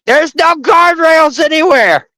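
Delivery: very high-pitched mock panic; hammed-up frightened shriek; panicked yelp. Re-enacts panic on cliff road.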